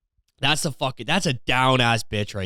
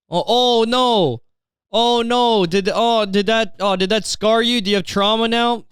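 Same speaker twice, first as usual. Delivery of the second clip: high, whiny fake concern; sneering put-on dismay; mock sympathy; 8 semitones higher, 3 dB louder, slower than usual. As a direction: taunt them with exaggerated pity. Mocking someone who was groped.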